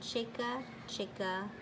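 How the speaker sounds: plain reading voice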